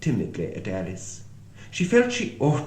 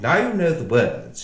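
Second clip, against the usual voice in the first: bossy Red Queen; 5 semitones higher, 7 dB louder than usual. Acted. Red Queen voiced in story.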